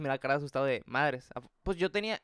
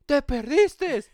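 loud, high holler across a distance; imitated shout; 12 semitones higher, 11 dB louder, much slower than usual. Stranger yelling at a scared, lost passenger, imitated shout. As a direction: yell it like the man at the back. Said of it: "un señor me grita al fondo".

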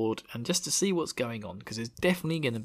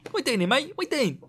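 much higher, silly put-on voice; mock matey questioning; 3 dB louder than usual. Warrior quizzing Rand, high mock-matey voice. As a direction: squeak the question out chummily.

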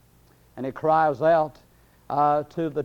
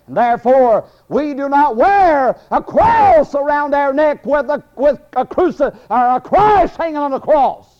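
high, loud pulpit preaching; full-force preaching; 10 semitones higher, 11 dB louder than usual. Real, not acted. Preacher telling the congregation why they wear no cross, full-force preaching.

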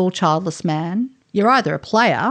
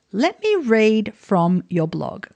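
ordinary talk, nothing marked

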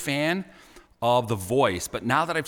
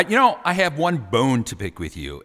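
ordinary delivery

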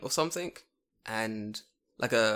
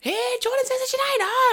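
very high, squealing child imitation; excited kids' voice; 4 dB louder than usual. Third graders spotting their teacher, shrill excited squeal. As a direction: call out like teasing little kids.

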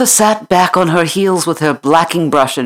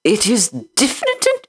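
put-on high, disdainful little girl; 6 semitones higher, faster than usual. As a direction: sniff at the idea. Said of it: "said Mistress Mary disdainfully".

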